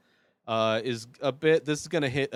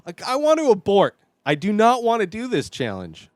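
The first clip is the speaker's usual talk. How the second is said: loud, high-pitched pleading refusal; panicked protest; 8 semitones higher, 7 dB louder than usual. Real, not acted. Host wanting to abort the challenge, panicked protest. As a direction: protest as if desperate to back out.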